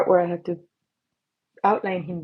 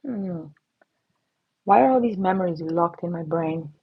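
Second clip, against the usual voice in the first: normal talking voice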